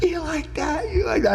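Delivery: high, strained mock yell at the crowd; retold taunting scream. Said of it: "screaming at them".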